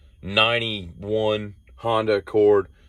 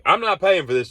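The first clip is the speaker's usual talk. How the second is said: high, pointed declaration; indignant; 9 semitones higher than usual. Retold or imitated. Telling roommate he won't pay, high indignant. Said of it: "And I'm like".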